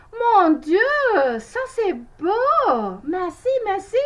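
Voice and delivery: high, loud, overjoyed; delighted cry. A narrator's reading. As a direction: gasp with delight, slowing on beau. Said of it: "she cried".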